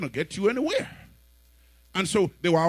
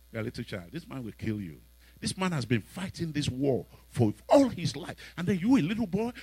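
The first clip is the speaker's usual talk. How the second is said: put-on low, soft patronising old man; a hint of a doubting old king; 6 semitones lower, 6 dB quieter than usual. Old king warning young David, low and soft. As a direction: talk down to a little boy.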